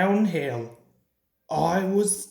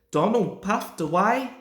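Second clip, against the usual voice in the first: ordinary delivery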